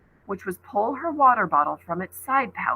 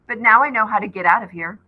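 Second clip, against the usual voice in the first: louder and quicker, pushing back; defiant; 11 dB louder, faster than usual. A narrator's reading. Ollie defying Seth, loud and quick. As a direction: say it like you've found the way out.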